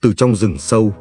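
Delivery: plain reading voice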